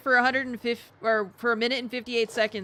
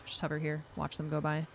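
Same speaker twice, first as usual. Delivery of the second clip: ordinary talk, nothing marked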